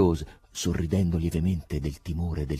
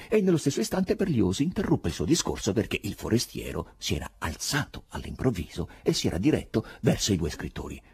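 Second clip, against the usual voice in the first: ordinary delivery, nothing marked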